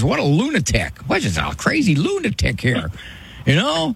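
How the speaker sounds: a touch of exasperated husband, rising high with a laugh; mock exasperated husband. Doctor mimicking the husband scolding his wife, mock exasperated. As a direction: lightly play the fed-up husband rolling his eyes. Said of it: "your husband looks at you and says".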